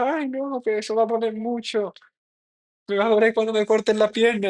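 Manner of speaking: put-on high, whimpering child's plea; retold child crying. Recalling a taxi ride to the doctor, imitating crying child. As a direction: play your scared little self near tears. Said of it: "Y yo llorando".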